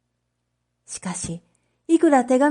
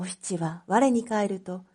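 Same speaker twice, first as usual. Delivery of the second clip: ordinary delivery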